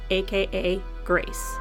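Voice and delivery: normal talking voice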